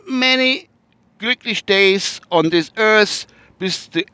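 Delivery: high, slow birthday toast; hammed-up birthday wish in broken English; mock formal greeting. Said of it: "birthday greeting in broken English".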